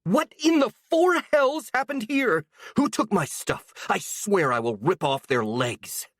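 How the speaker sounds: high, furious outburst; raging. Acted. A furious woman finding her room emptied, raging. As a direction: demand answers in fury. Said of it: "her face red with rage".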